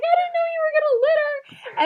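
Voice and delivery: shocked disbelief. Retold or imitated. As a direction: squeal it in mock shock. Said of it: "I'm like".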